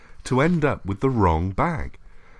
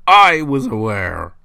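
slow, louder hissed words; hissing; 8 dB louder, slower than usual. A narrator's reading. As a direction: hiss it with menace. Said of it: "hissed the voice".

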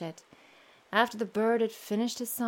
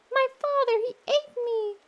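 much higher, louder bird voice; bird's song; 14 semitones higher, 5 dB louder than usual. Acted. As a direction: pipe it out high and bright. Bird sings again.

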